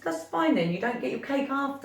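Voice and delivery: mock-firm warning to a fussy child; stern mum. Mum retelling telling off daughter.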